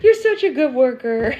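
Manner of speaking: put-on sweet baby-talk praise to the unborn daughter; husband praising the baby. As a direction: coo it gently to the bump. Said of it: "And he goes".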